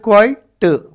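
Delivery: "क्वाइट" as kwai, gap, tuh; split into syllables. Shown as a demonstration. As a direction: separate the final T of "क्वाइट". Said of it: "कितने हो रहे हैं यहाँ पे". ("क्वाइट" में आख़िरी ट अलग)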